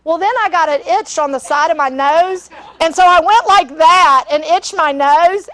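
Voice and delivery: ordinary talk, nothing marked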